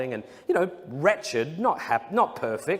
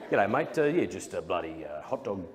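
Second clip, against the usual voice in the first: lower, mumbled Australian ask; sheepish Aussie ask; 8 semitones lower, 4 dB quieter than usual. Retold or imitated. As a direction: ask for a free hot dog without eye contact. Asking Christians for free hot dog.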